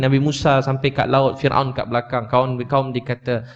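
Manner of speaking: ordinary talk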